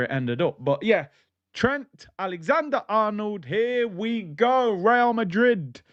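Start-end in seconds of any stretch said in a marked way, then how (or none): 1.5-5.8 slow, higher transfer-news announcer voice, stretching each word; Jimmy Corkill. Retold or imitated. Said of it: "Jimmy Corkill settings".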